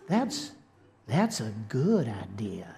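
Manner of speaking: hushed, whispery Southern devil; devil's North Carolina accent. Acted. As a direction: tempt softly in a put-on Carolina accent. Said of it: "the devil picks up on it, and he's like".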